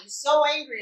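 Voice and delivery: ordinary delivery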